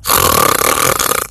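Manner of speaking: snore noisily to tease; imitated snoring. Three-year-old teasing his brother, fake snoring. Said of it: "our three-year-old [name] just starts going".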